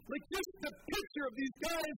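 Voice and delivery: usual speaking voice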